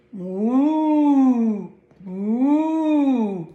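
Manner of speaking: lowing moo; cow moo. Acted. Kathy the cow showing her moo, proud lowing.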